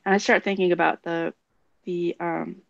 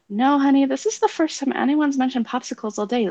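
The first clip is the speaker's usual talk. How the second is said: higher-pitched parent voice; retold gentle contradiction, pitched up; patient correction; 7 semitones higher than usual. Parent correcting a son about popsicles, high and insistent.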